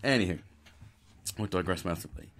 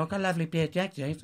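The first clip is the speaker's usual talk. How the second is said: put-on higher, quick posh little boy; mock posh English, pitched up; 7 semitones higher, faster than usual. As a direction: put on a hurried posh accent. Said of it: "In a posh voice".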